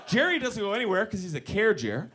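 usual speaking voice

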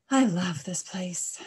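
slow, breathy sighed words; contented sigh. A narrator's reading. Mister Cow settles down happily, sighing it slowly. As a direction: let it out loudly on a long sigh. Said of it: "He let out a sigh".

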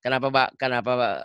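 normal talking voice

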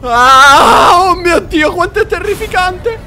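high, loud frightened wail; terrified cry. Real, not acted. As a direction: cry out in terror. Mostro terrificante. (grido terrorizzato e acuto)

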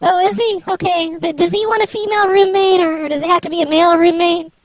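voice-changed woman's voice; fake female voice. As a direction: play a woman over the phone. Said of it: "I can stop with this whole charade thing".

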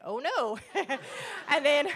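laughing through a mock dismayed oh no; amused mock alarm, laughing. Real, not acted. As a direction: giggle out a playful groan. Laughs at her own reaction.

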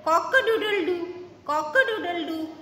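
high crowing cock-a-doodle-doo; rooster crow. Shown as a demonstration. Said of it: "A rooster says".

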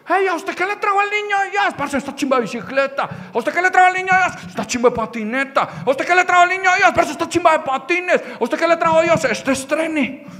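put-on high-pitched rapid kid chatter; imitating thrilled children calling out; excited kids boasting. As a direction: show off the new present at a high pitch. Kids showing off Christmas presents.

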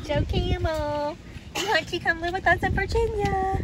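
high, coaxing call to an animal; high-pitched, sweet invitation. Real, not acted. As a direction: coax a camel like a pet. Invites a camel home.

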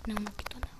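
ordinary talk, nothing marked

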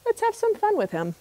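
high sly crow whisper-tone; scheming, high and hushed; trickster tale voices. Acted. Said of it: "Old Man Crow said".

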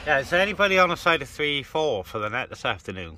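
usual speaking voice

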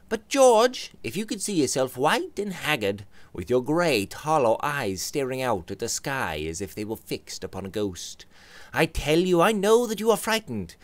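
Robert's voice. Acted. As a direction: push the point home.

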